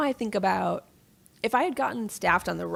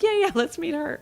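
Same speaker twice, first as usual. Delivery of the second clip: higher-pitched, keen imitation; eager mock quote; 9 semitones higher than usual. Imagined eager staffer in retold quote.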